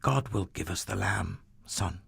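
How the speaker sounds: soft, heavy-hearted father; gentle father. Acted. Abraham answers his son on the mountain, soft and grave. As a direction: quietly reassure him, hiding your grief. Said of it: "Abraham said".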